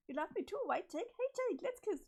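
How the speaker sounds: high, bubbly and overexcited; high-pitched manic sweetness; giddy Jane voice. Acted. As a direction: gush at Jake like a lovestruck girl gone strange. Jane pushes Jake.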